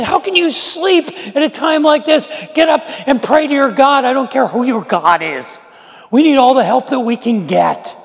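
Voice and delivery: the storm-shaken captain shouting Jonah awake; urgent shout. Retold or imitated. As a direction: roar at him to get up and pray. Captain wakes sleeping Jonah.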